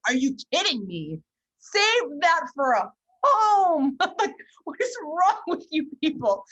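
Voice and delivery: high, loud, drawn-out exasperation; hammed-up outrage, stretching every word; retold mad outburst. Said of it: "I got mad".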